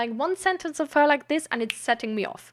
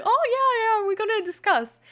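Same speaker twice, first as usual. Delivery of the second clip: mocking mother; 7 semitones higher than usual. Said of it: "being like".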